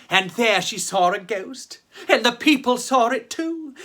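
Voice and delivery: ordinary delivery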